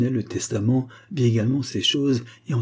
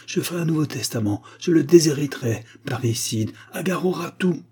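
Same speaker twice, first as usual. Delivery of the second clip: higher-pitched vehement declaration; energetic; 6 semitones higher than usual. A narrator's reading. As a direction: lay down the law with energy. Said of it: "dit Jacob avec énergie".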